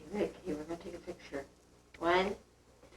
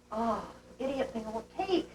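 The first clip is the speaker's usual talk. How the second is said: ordinary delivery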